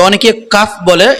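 ordinary talk

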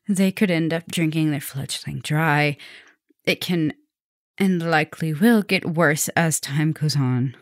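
ordinary talk

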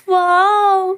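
delighted gasp of surprise; amazed wow. Acted. Shopkeeper marvels at the crowded stall, amazed.

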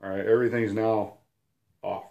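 usual speaking voice

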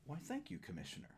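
high-pitched opening, falling away on "Commissioner"; lightly acted gracious thanks; mock grateful participant. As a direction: play the flattered recipient, thanking yourself. Answers himself as grateful participant.

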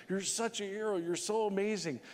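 hammed-up syrupy host; gushing TV hosts. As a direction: mimic badgering interviewers. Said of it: "they're badgering him".

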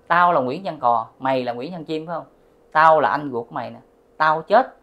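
loud, insistent retold declaration; acted confrontation. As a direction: assert your identity to his face. Spirit declares himself, pointing at father. (giả giọng vong lớn tiếng khẳng định)

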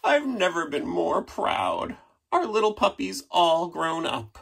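proud parent. Acted.

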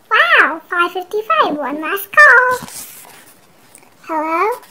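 ordinary delivery

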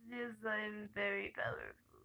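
whispered mock gloat; smug whisper. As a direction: whisper it like a smug evil mastermind. After killing everyone.

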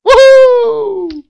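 very high, loud whoop of delight; old grandfather celebrating, a loud whoop; old man's whoop. Retold or imitated. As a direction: let out a joyful holler.

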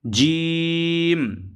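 জিম drawn out long for three alif counts; three-count stretch. Shown as a demonstration. Teacher stretching জিম for young students. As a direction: hold the vowel of জিম long and level. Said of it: "মানে তিন আলিফ পরিমাণ টানতে হবে".

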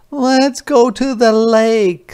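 put-on high, bright child voice; eager little kids begging; kids asking. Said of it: "said Junie and Jakey".

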